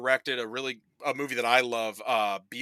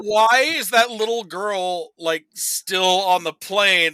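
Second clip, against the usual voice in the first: louder, higher incredulous question; retelling an outraged reaction, pitched up and loud; incredulous outrage; 6 semitones higher, 6 dB louder than usual. Retold or imitated. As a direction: demand it in mock disbelief. Retold outraged reaction to film.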